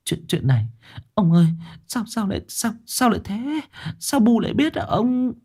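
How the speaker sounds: high, halting, stammering alarm; a frightened wife stammering to her husband; hushed high panic, words tripping; panicked. A narrator's reading. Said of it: "Bà The hốt hoảng bấu vai chồng".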